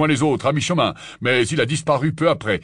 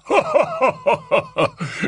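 mirthless, higher-pitched chuckle; joyless laugh; 8 semitones higher than usual. A narrator's reading. Kingsley reacts to talk of Azkaban, joyless laugh. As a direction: let out a hollow laugh. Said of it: "laissa échapper un rire sans joie".

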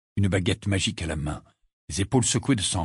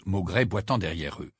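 usual speaking voice

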